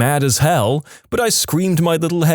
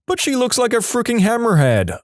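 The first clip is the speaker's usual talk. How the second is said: high-pitched upset dad; hammed-up high-voiced dismay; mock distraught protest; 10 semitones higher than usual. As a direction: protest in a much higher voice, as a worried father insisting. Distraught dad's repeated protest, acted.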